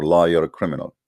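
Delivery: ordinary talk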